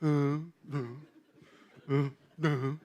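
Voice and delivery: low, soft grudging grunts; reluctant grumble. Acted. Preacher mimicking someone told to clean their room, reluctant grumbling. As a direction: mimic a kid mumbling in protest.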